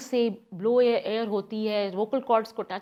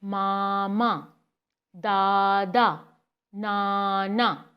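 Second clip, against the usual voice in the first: मामा, दादा, नाना said very slowly, each syllable drawn out; slow modelled words; 2 semitones lower, much slower than usual. Shown as a demonstration. Parent shown how to model easy words, मामा दादा नाना very slowly. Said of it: "ये वो लफ्ज़ हैं जिनमें पहली आवाज़ ... repeat".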